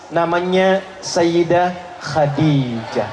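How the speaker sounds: low, drawn-out reveal of a name; slow reveal. Real, not acted. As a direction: stretch the name out slowly, with weight. (pengungkapan nama pelan dan khidmat)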